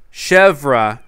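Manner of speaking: repeated loud calls; calling out. Real, not acted. Calling out over and over for the missing villager. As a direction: keep calling her name out loud.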